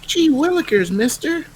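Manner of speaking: put-on high gee-whiz kid voice; wholesome 1950s kid. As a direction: chirp it like a newsboy. Old-timey kid exclamation.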